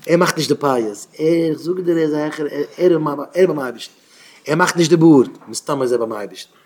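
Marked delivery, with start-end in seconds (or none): none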